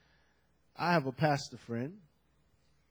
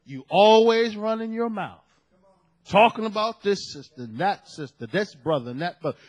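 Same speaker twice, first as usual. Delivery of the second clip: loud opening, then a rattled-off list; retold telling-off, easing in volume; acted scolding; 7 dB louder than usual. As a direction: imitate a fed-up pastor listing offenses. Acts out pastor scolding gossiping sister.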